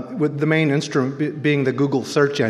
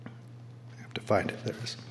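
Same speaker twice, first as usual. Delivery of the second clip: whispering; 6 semitones lower, 17 dB quieter than usual. Real, not acted. Aside while searching for page.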